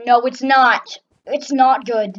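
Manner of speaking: loud, emphatic denial; exasperated outburst. Real, not acted. Player dropping the pretence about the game, exasperated outburst. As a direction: burst out with it, punching "not".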